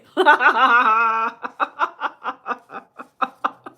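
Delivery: loud open laugh; big laugh. Real, not acted. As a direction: let the laugh burst out. Laughing at viewer's joke.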